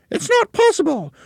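very high, loud wife voice; high cry of denial. A narrator's reading. Wife denying that she is shrinking, high disbelieving cry. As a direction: squeal it out in disbelief.

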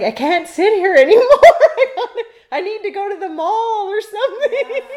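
high, loud laughing complaint. Retold or imitated. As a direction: laugh it out, fed up. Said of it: "you're just like".

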